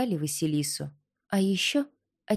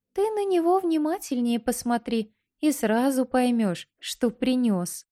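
too calm creepy doll; 6 semitones higher than usual. Acted. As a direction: let the doll hint, calm and eerie. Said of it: "раздался голос куколки".

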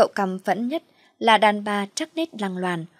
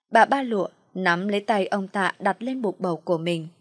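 ordinary delivery, nothing marked

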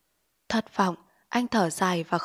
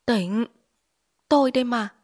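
higher, louder scared cries; frightened calling; 4 semitones higher, 4 dB louder than usual. Acted. Frightened wife calling her husband out of delirium. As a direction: call him back to his senses, afraid. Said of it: "Chị San sợ hãi ... gọi".

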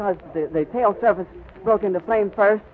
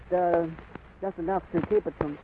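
ordinary talk

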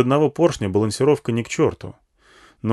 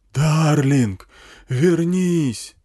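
high, drawn-out bullhorn call; yelling through amplifier; 6 semitones higher, 4 dB louder, much slower than usual. A narrator's reading. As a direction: beg her back over a loudhailer. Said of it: "орал усилитель".

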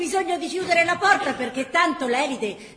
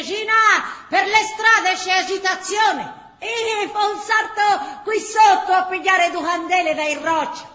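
loud warning call on the stairs; coal man calling out; 3 semitones higher, 5 dB louder than usual. Acted. The coal man calls out to Gina in the hallway, loud warning. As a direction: warn her over the noise.

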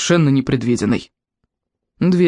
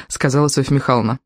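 plain reading voice

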